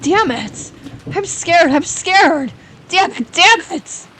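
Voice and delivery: high, frightened cursing; near-tearful panic. Acted. Shuddering writer on the verge of tears, scared cursing. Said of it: "his face on the verge of tears".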